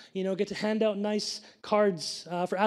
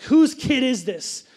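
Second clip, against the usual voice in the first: loud, slow, higher retold crowd question; indignant astonishment; 4 semitones higher, 8 dB louder, slower than usual. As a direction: act out a stunned crowd, drawing it out loudly. Crowd's shocked question, acted out.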